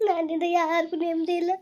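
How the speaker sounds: sobbing self-blame, pitched high; high, tearful despair; crying. Acted. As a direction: weep between the phrases. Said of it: "ಅಳ್ಬೇಡ ಕಣವ, ಅಳ್ಬೇಡ ನೀನು".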